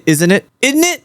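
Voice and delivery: mock British accent. Shown as a demonstration. User mocks the AI's attempt, British accent. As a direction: parody how the English say it. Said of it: "Isn't that how they say".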